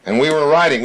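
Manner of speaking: ordinary talk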